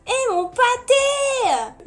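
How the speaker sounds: very high, loud, slow wail over the lost sandcastle; drawn-out mock-tragic cry. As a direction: mourn the sandcastle like a child. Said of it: "Qui est-ce qui a la ref?".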